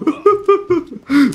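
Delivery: high, mischievous laugh; evil chuckle. Real, not acted. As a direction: laugh at your own crime. Leaves the man to fall. (risa traviesa y aguda)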